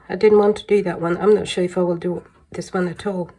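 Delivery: ordinary talk, nothing marked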